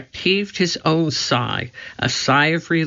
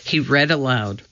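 ordinary delivery, nothing marked

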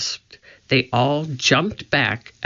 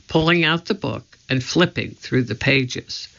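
ordinary delivery, nothing marked

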